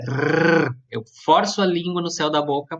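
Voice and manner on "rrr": Rrr as a long trilled R; rolled R. Shown as a demonstration. Teacher demoing the trilled R for students. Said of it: "de forma bem tremida".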